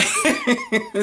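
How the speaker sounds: a very high burst of laughter; squealing giggle. Real, not acted.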